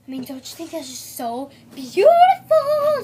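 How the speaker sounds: loud gushing question. Real, not acted. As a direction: rave about it loudly.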